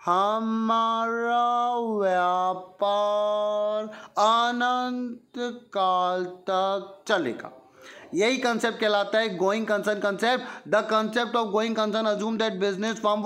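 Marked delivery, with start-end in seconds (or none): 0.1-7.5 very slow, each word stretched out; slow motion. Shown as a demonstration. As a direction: drag every syllable in slow motion. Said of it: "इसको slow motion में बोलता हूँ".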